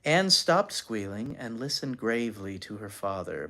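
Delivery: ordinary delivery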